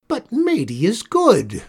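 high, loud whining protest; old witch whining in defence of her helper; whined. A narrator's reading. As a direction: whine it like a hurt child. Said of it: "whined Critch".

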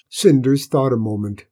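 ordinary delivery, nothing marked

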